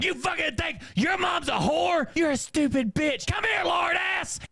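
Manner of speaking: high-pitched mock-furious screaming; hammed-up shrieking tirade; screaming abuse. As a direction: bellow insults up high like a raging mom. Mom screams while hitting son.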